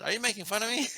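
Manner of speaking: high, rising incredulous question; mock suspicion. Man to his date after her compliment, mock suspicion. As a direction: replay the doubt lightly, pitch lifted.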